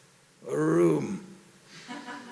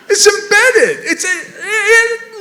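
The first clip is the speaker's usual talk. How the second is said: insisting through laughter; half laughing, half pleading; 11 semitones higher, 8 dB louder, slower than usual. Real, not acted. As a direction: laugh while pressing the point.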